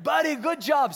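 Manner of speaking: loud, high praise; cheering call. Retold or imitated. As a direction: call out to get his attention.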